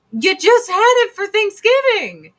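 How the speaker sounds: high, loud mock outrage; hammed-up exasperated yell; incredulous protest. Acting out protest at friends' menu.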